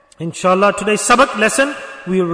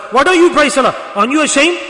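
high, raised-voice telling-off; mock scolding; 5 semitones higher, 6 dB louder than usual. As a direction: act out a self-righteous rebuke. Acted scolding of a non-praying man.

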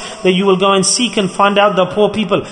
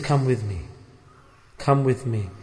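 softer, gentle call; tender invitation; 7 semitones lower, 9 dB quieter than usual. Pretend. Prophet inviting the orphan home, soft and tender. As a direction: invite him softly and kindly.

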